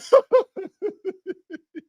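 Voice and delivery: shrill peal of laughter; high squealing laugh. Real, not acted. Host cracking up at list.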